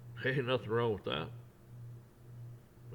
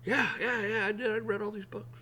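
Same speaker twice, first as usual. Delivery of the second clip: eager yes; 9 semitones higher than usual. Retold or imitated. Kid proudly confirms.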